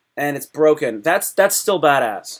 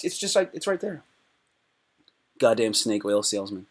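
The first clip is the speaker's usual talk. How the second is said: normal talking voice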